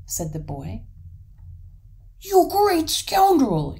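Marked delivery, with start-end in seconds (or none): 2.2-3.8 slow, drawn-out, high yell of rage; enraged troll bellowing at high pitch; furious yell. A narrator's reading. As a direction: yell the accusation in a towering fury. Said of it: "yelled the troll furiously".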